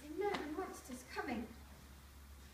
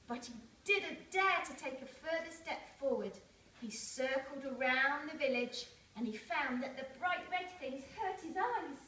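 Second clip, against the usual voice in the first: ordinary delivery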